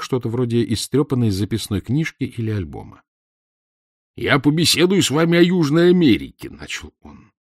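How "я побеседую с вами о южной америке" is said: higher, emphatic professor opening his talk; self-important professor. A narrator's reading. Professor to his visitor about South America, self-important. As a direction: announce the subject with lecturing authority.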